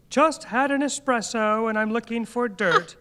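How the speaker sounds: high, perky parody of a poster; lightly voiced caffeinated gossip; mock gossip post. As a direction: send up a nosy gossip online.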